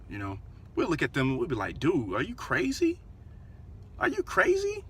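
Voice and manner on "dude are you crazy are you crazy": high-pitched incredulous comeback; acted incredulity. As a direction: voice leaps up in mock disbelief. Acting out shocked reply to insult.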